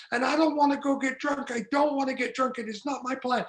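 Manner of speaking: usual speaking voice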